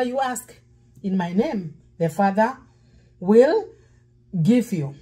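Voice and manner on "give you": ordinary talk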